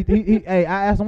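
ordinary talk

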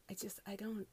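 unvoiced exasperated whisper. Real, not acted. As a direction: breathe it out, at a loss. Exasperated trailing off.

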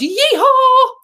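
loud, very high-pitched whoop; exuberant yell. Retold or imitated. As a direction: let rip a triumphant cowboy yell. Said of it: "I yelled".

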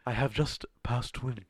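a light put-on British accent, slowed; mock British accent. Joking about passing wind in a British accent. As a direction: announce it like a proper Brit. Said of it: "why are you speaking in a British accent".

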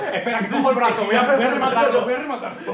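half laughing, shouting out a gleeful plan to finish off the arm; gleeful mock boyfriend. Friend acting out the boyfriend grabbing the arm, loud and laughing.

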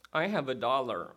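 put-on sheepish little boy. Preacher voices Johnny confessing to his teacher, sheepish and subdued. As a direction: admit it reluctantly, as the punchline. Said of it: "the boy stammered".

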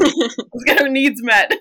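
high, slowed, playful rallying cry; hammed-up cheer to go make friends; mock eager resolve. New mom abroad rallying herself, mock eager. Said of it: "where you're just like".

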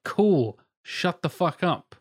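exasperated, drawn-out brush-off; sarcastic dismissal. Acted. Viewer dismisses a guitarist pointing out chords, sarcastic. Sarcastic retort to reaction video.

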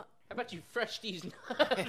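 high laughing jeer; laughing taunt. Pretend. Crude joke at customer.

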